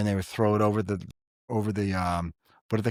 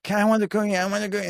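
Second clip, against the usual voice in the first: high, whining plea for a cookie; whiny child voice; 10 semitones higher, much faster than usual. Retold or imitated. A father mimicking a whining child asking mom for a cookie. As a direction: mimic a whining kid, pitched up. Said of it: "the mom would be like".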